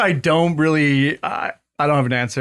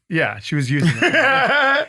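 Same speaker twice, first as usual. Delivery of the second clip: laughing; 5 semitones higher than usual. Real, not acted. Laughing while describing her voice.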